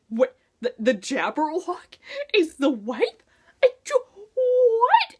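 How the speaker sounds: stunned, almost crying out in disbelief; shocked disbelief. Retold or imitated. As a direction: voice jumps high and cracks on the last what. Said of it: "and I was just like".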